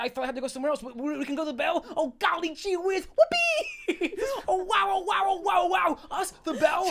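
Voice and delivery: high, loud, squealing glee; giddy excitement. Acted. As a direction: bounce off the walls with joy. Character thrilled about the bell.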